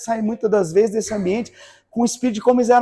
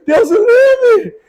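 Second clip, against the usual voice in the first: much higher and slow, mock panic; horrified cry; 10 semitones higher, 5 dB louder, much slower than usual. Pastor refusing to cut faster, high slow mock-horrified cry.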